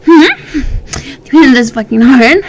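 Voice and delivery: loud taunting. Acted. Lover loudly taunting her partner during sex. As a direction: taunt him hard.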